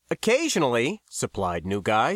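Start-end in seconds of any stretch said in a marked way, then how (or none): 0.1-1.0 higher, self-satisfied lilt; smiling reply. A narrator's reading. New Guy supplies an answer to Max, still smiling. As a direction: answer through a smile. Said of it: "still smiling".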